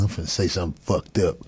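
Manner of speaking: low, soft sly mutter of a troublemaker; lightly voiced sneaky heckler testing him. Comedian voicing a heckler plotting a dig, low sly mutter. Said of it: "People just automatically be like".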